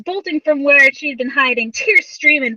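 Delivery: usual speaking voice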